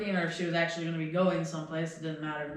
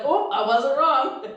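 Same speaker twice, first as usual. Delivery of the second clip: loud, high, drawn-out and laughing; gleeful realisation; 7 semitones higher, 8 dB louder, slower than usual. Retold or imitated. Driver retells seeing hunch confirmed, gleeful laughing crow. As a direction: crow it slowly through a laugh.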